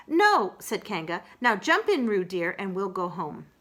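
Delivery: put-on firm no then call to child; Kanga voice.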